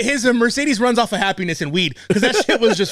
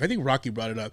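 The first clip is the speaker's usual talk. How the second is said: ordinary delivery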